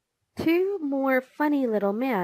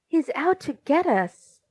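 higher-pitched cry of alarm; a touch of panic, pitched up; 6 semitones higher, 3 dB louder than usual. A narrator's reading. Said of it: "Tubby cried".

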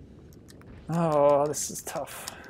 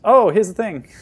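loud, higher burst of surprise; delighted find, much louder; sudden discovery; 7 semitones higher, 15 dB louder than usual. Real, not acted. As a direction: blurt it out as you spot the answer. Stumped player suddenly spots it.

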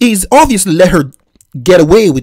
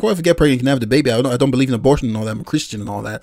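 calm quiet reply; 7 semitones lower, 7 dB quieter, much faster than usual. Retold or imitated. His calm reply to her.

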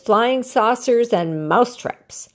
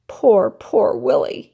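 sad, pitying; 3 semitones higher, slower than usual. A narrator's reading. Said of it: "he thought".

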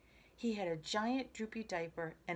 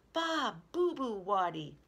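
put-on baby talk; 2 semitones higher, 5 dB louder than usual. A narrator's reading. Baby Louie copies the family's scolding, baby talk. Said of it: "baby Louie said".

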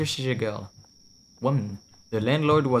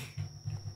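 silent wheeze laugh; 14 dB quieter than usual. Real, not acted. Laughs again, nearly silent.

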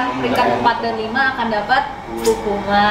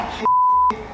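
very high burst of giggling; high giggle. Real, not acted. Host laughing while guessing the kingdom, high giggle. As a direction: let a squealing laugh escape.

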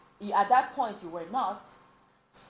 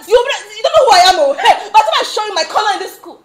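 loud, high, rapid-fire threat; animalistic ranting; 11 semitones higher, 13 dB louder, faster than usual. Retold or imitated. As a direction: imitate a wild friend showing off, half laughing. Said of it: "My friend went all animalistic".

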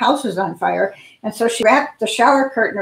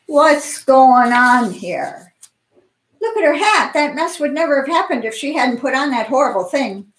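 pompous mayor; 4 semitones higher than usual. Acted. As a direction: bluster like a self-important official. Mayor McTwaddle waddles over.

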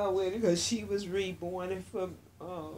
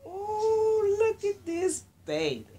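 high, louder cry of admiration; delighted cooing; 13 semitones higher, 5 dB louder than usual. Real, not acted. Showing the new baby to viewers, delighted high coo.

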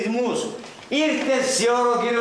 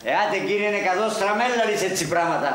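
ordinary talk

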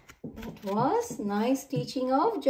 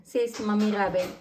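plain reading voice

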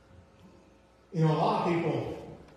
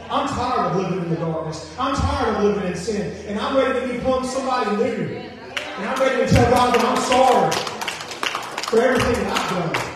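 preacher's plea building louder and higher; fervent declaration; 5 semitones higher, 6 dB louder than usual. Acted. Preacher voicing somebody ready to repent, building fervently. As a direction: build to an impassioned, loud confession.

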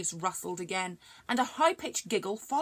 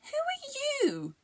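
high, slow, wary questioning voice; suspicious; much slower than usual. Acted. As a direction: squint at the stranger and ask slowly. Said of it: "Lily asked suspiciously".

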